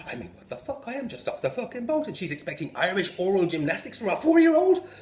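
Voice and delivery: ordinary talk, nothing marked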